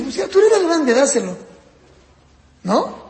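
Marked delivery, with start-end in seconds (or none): 0.0-1.4 higher, louder mock parent; impatient parent. Parent telling the older child to give up his toy, mock impatient. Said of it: "Entonces, ¿qué dice?".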